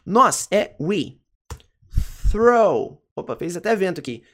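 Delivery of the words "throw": "Throw" with a strong airy TH; English TH in throw. Shown as a demonstration. Teacher demonstrating the TH to learners, crisp "Throw". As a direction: say "Throw", tongue caught for the TH, higher and louder. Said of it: "Pra produzir esse som do T H".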